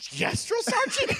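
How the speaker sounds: very high, drawn-out recruit shout; stretched, high-pitched mock recruit answer; recruit responding. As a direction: squeal the reply to your sergeant. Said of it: "They're like".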